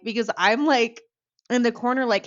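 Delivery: ordinary talk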